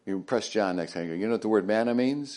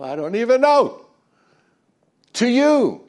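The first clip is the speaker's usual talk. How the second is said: exasperated exclamation; 13 semitones higher, 8 dB louder than usual. Real, not acted. Preacher to congregation, exasperated high outburst. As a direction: voice jumps much higher and louder, bewildered.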